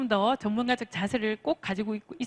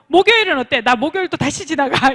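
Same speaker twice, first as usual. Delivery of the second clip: louder, higher comeback offer; eager persistence; 4 semitones higher, 8 dB louder than usual. Shown as a demonstration. Coach acting out offering Thursday instead, eager and loud. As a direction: jump straight in with another day. Acted pushy rescheduling.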